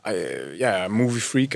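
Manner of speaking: normal talking voice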